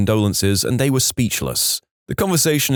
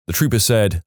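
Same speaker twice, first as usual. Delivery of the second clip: ordinary delivery, nothing marked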